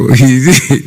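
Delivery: a short burst of real laughter; amused laugh while reading. Real, not acted. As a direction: laugh out at what he said. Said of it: "I'm laughing".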